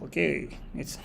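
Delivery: ordinary talk, nothing marked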